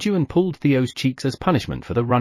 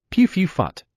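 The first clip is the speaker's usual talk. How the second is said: cat spluttering noise; 7 semitones higher than usual. Acted.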